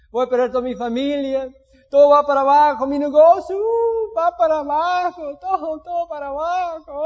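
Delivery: loud, high, overdone despairing wail; dramatic wailing. Retold or imitated. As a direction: ham up the panic, everything is collapsing. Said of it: "Qué dramático". (el creyente quejumbroso se lamenta ante Dios con gemido exagerado)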